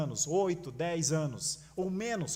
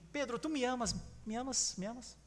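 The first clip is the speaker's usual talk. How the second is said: higher, softer pleading question; gentle questioning; 6 semitones higher, 6 dB quieter than usual. Acted. Jesus gently asks Peter if he loves him, softly. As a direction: ask gently, coaxing an answer.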